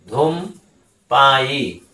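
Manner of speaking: ধূমপায়ী drawn out slowly and clearly; a teacher dictating ধূমপায়ী slowly; slow dictation. Shown as a demonstration. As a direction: spell out ধূমপায়ী slowly for students. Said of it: "teacher repeats word slowly".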